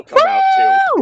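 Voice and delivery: loud, high, slow shout of defiance; mock shout. YouTuber quoted, shouting in defiance. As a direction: belt it out like throwing caution away. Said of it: "So he's like".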